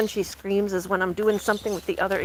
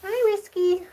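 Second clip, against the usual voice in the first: high pet greeting; 10 semitones higher, slower than usual. Real, not acted. Greeting her goat.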